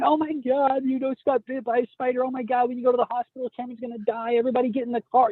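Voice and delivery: high-pitched imitation of panic; mock freak-out. Parent imagines partner panicking over a spider bite, mock freak-out. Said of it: "She didn't start freaking out going".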